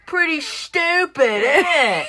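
high-pitched cheeky British lad impression; mock British accent. Joking to a friend about a shark, mock British accent. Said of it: "British people be like".

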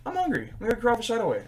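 high, eager mock craving; 1 mock hungry craving. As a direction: act out a sudden hungry urge. Acting out going to eat.

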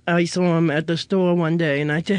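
usual speaking voice

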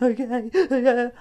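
hammed-up weepy agreement; mock tearful. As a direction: imitate giving in through tears. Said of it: "like, cried".

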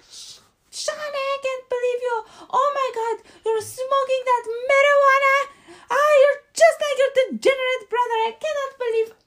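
very high-pitched mock outraged mother; snapping mom. Mother catching her kid apparently high, snapping in a very high mock voice. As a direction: shriek it like an exaggerated, scandalized mom. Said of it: "immediately snapped. She was like".

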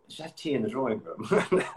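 mock upper-class posh accent. Imagining her on Downton Abbey, mock posh accent. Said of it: "doing your posh accent in the scene".

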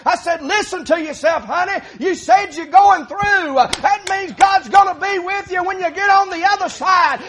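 high, loud pulpit delivery; fiery preaching. Acted. Preacher urging folks through the storms, loud fiery preaching. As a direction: drive it home at full preaching force.

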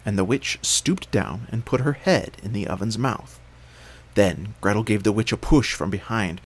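usual speaking voice

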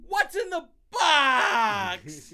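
high, slow anguished yelling with a laughing cry; desperate shout. Retold or imitated. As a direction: scream it like the crying Seven finale. Quoting the movie Seven.

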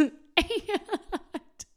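a high, delighted laugh bursting out; real amused laugh; high burst of laughter. Real, not acted. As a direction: laugh loud and high at yourself. Laughing at own story.

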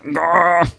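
high playful growl; competitive growl. Retold or imitated. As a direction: growl like an angry gamer. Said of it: "and I'm just there like".

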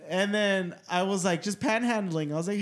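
usual speaking voice

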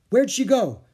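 put-on high, loud girlish cry; urgent question. Annie losing sight of Peanut, urgent. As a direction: call it out in sudden urgency.

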